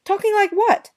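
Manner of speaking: high, sharp offended question; icy indignant challenge; affronted. Acted. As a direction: throw it back at him, stung and haughty. Affronted retort.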